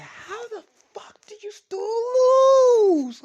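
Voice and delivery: very high screech, stretched out long; yelled outburst. Retold or imitated. Issei demanding how they still lost, screeching. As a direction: wail it loud and slow. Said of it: "he just yelled this out".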